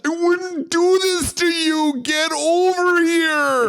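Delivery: game catchphrase shout. Pretend. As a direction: bellow like Scorpion.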